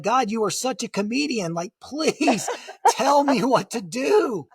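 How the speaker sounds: laughing plea. Retold or imitated. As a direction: laugh at the irony while still begging God. Ironic prayer, laughing.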